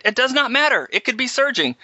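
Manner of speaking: high, insistent mock scolding; imitated yelling. Pilot imitates her overruling him about the brakes, high mock scolding. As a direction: cut in with a high-pitched imitation of her telling off. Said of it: "And she's yelling at me".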